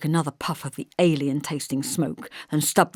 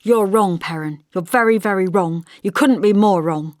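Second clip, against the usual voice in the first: scornful; 3 semitones higher, 7 dB louder than usual. A narrator's reading. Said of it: "I looked at him scornfully".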